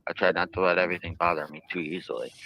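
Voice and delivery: ordinary delivery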